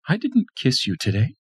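wife accusing over lipstick; accusing Tanya. A narrator's reading. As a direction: accuse him.

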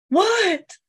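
very high startled what; imitating the boy. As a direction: squeak it out like a scared kid. Boy's voice imitated.

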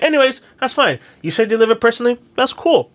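high-pitched, drawn-up acted politeness; placating reply. Customer letting the seller's excuse go, high placating voice. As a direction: lift the voice high to let it slide.

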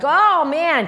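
high, loud oh man; a kid bursting with excitement; excited. Retold or imitated. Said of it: "I was like".

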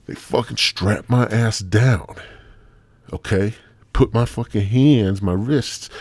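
ordinary talk, nothing marked

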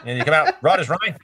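put-on easygoing Aussie accent, no worries; teasing Australians as tough, in a thick Aussie accent. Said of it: "Aussies are the toughest people".

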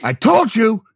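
angry dad. Pretend. Dad voice in skit.

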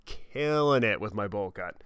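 slowed, relishing every word; slow, smug repeat; slow savoring. Real, not acted. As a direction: draw it out with pride.

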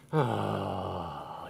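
long, low, soft exhale; long reluctant sigh. Acted. Pastor asked his job by other parents, sighing heavily. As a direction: let out a drawn-out sigh of dread.

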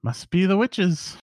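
put-on high, slow old lady voice; slow, high-voiced village crone. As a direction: gossip like an old woman, high and drawn out. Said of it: "there's two just old ladies".

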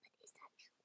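soft breathy whisper. Real, not acted. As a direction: hush it like a secret. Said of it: "Why are you whispering?".

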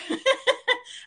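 a quick high real laugh; high giggle. Real, not acted. Laughs after her quote.

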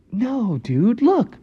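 high-pitched reassuring frog voice; high, insistent little frog; Froggy character. Acted. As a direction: explain it in a high cartoon voice.

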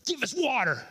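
high, pushy demand. Retold or imitated. People demanding from Moses, mock demanding high voice. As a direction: snap out a high entitled demand. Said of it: "Just like that. That's how it sounded".

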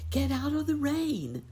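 put-on high urgent call to the children; urgent cry. A narrator's reading. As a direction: call them in from the rain, high. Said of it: "cried Nurse Jane".

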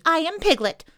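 high, loud little voice; loud insistence. A narrator's reading. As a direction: declare who you are at full volume. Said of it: "said Piglet loudly".

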